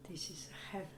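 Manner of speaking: soft whisper, voice gone; raspy whisper. Real, not acted. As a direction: whisper it, hoarse and hushed. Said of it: "I have no voice".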